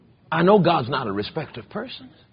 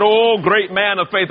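mocking; 7 semitones higher, 5 dB louder than usual. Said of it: "my wife started laughing at me".

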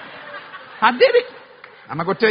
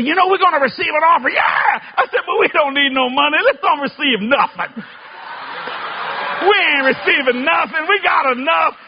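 retold preacher shout; 8 semitones higher, 3 dB louder than usual. Hyping crowd from the piano.

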